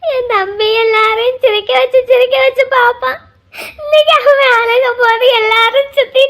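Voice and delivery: drawn-out wail of pity; tearful lament. Acted. Sister pities her suffering younger brother, wailing lament. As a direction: grieve aloud through tears.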